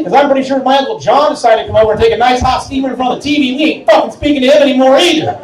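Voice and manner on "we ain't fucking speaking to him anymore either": ordinary talk, nothing marked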